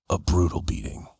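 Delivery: low, gruff and quiet; gruff mentor voice. Retold or imitated. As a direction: drop into the hard man's deep grumble. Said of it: "he's just a very gruff guy".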